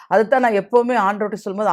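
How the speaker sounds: usual speaking voice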